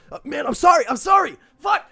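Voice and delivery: high, shouted panicked apology; frantic apology. Acted. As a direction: yell sorry in a frantic panic. Acting out enemy who stabbed ally.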